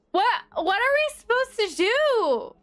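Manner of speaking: ordinary talk, nothing marked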